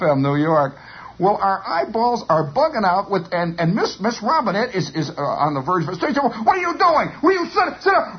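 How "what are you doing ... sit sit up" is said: high, flustered teacher's outcry; scolding teacher. Retold or imitated. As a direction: imitate a teacher losing control of a pupil. Flustered teacher scolding charging pupil.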